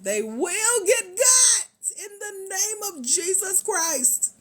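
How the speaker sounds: high, drawn-out hype shout; emphatic shout. Real, not acted. Host warning viewers spots will go, high emphatic shout. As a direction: proclaim it loudly like a rousing declaration.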